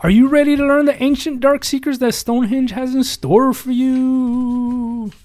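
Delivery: a hint of a spooky narrator, rising up; dramatic intro read. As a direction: tease the dark secrets like a trailer. Reads book intro dramatically.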